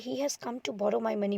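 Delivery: softly, as a private thought; quiet aside meant only for the audience. Acted. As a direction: drop the voice for an aside. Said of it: "audience को सुनाने के लिए कुछ कहता है".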